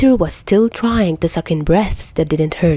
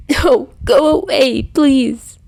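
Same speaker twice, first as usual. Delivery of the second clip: high, pleading, tearful; desperate plea; 7 semitones higher, 4 dB louder, slower than usual. Acted. Peter begging through the door, high tearful plea. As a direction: beg them to leave you alone.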